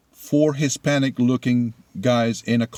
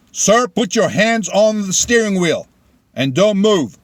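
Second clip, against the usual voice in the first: loud, higher, firm order from a cop; forceful police command; 8 semitones higher, 5 dB louder than usual. Retold or imitated. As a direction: bark the order like an armed officer. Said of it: "and a very forceful way saying".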